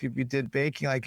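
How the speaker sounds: ordinary talk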